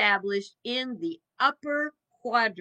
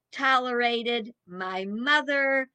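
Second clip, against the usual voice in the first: normal talking voice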